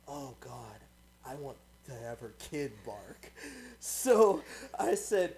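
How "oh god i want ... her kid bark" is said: low, hushed inner thought; inner voice, much softer. Retold or imitated. Gamer thinking to herself, hushed and low. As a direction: murmur it to yourself, low and soft. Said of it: "I was thinking in my head".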